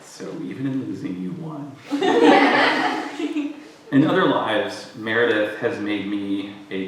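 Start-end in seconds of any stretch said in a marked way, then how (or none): 1.8-3.9 warm burst of laughing; laughter. Real, not acted. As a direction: laugh out loud. Laughing at a joke.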